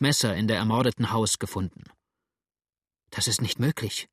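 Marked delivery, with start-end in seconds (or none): 3.1-4.1 a touch of narrated horror; mild dismay, not believing it; horrified. A narrator's reading. Adele hears James is suspected, mild horror. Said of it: "rief Adele entsetzt".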